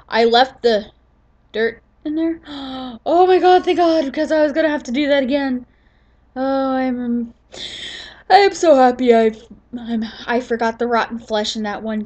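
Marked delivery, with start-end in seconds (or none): 2.4-3.0 quick gasp of relief; sharp gasp. Real, not acted. Relief at finding item. 3.1-9.4 relieved. Real, not acted. Relief it was not lost.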